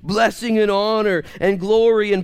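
high, loud pulpit preaching; fiery preacher driving the point home; full-force preaching. Acted. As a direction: preach it out to the back row. Scripture read at preaching force.

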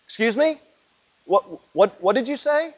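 a high, startled "excuse me?"; stunned and incredulous, voice jumping up; incredulous disbelief. Retold or imitated. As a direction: play the disciples' shocked double take. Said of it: "Peter and the rest of the disciples going".

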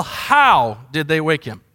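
ordinary delivery

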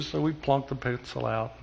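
normal talking voice

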